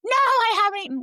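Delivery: mother mocks daughter's hungry reply, high and whiny; whiny teen daughter. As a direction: play the hungry sulking daughter. Daughter voiced in story.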